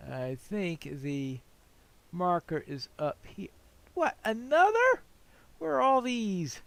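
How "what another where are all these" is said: high, loud startled "What, another?"; surprised. Real, not acted. Gamer startled by a new quest ring, surprised. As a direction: caught off guard by yet another quest.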